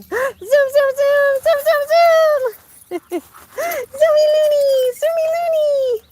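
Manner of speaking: loud high chant at the running dog; high squealing cheer. Real, not acted. Owner chanting as the dog zooms, high excited squeal.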